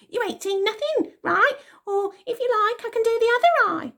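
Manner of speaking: put-on very high, fast little girl threat; tiny tot menace; little girl bully. A narrator's reading. Bella threatening Elsie, very high little voice.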